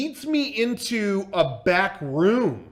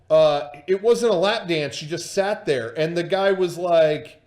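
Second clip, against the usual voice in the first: usual speaking voice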